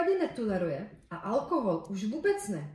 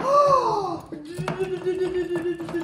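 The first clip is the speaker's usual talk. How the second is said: long, loud frightened gasp; startled gasp; 4 semitones higher, 6 dB louder than usual. Acted. Startled by the dragon.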